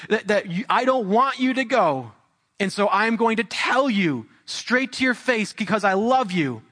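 high, insistent pulpit warning; impassioned preaching. Acted. Preacher warning the congregation of a real hell, fervent preaching. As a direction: press every phrase urgently on the listeners.

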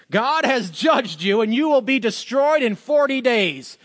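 slow, high-pitched mock store announcement; intercom doom announcement. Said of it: "the intercom over the".

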